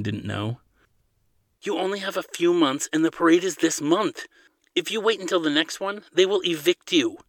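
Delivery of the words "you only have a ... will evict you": high-pitched, insistent reasoning; urgent warning. A narrator's reading. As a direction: spell out the deadline, voice raised in pitch. Friend warns of deadline in argument.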